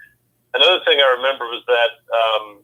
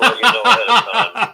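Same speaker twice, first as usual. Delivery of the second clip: laughing; 11 semitones higher than usual. Real, not acted. Teasing the quiz host.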